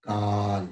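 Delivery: "काल" with the aa held long; long vowel demo. Shown as a demonstration. Teacher to class, showing the long vowel: "काल" drawn out. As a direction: stretch the aa in "काल". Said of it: "दूसरा है".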